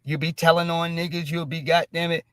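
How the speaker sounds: ordinary talk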